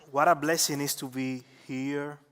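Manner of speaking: ordinary delivery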